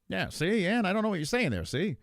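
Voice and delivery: high, swooping fifties broadcaster voice; Tom Aspinall. Acted. Streamer practising a fighter's voice, high old-time broadcaster impression. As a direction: ham up an old-time newsreel talker. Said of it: "Tom's got that w-, voice".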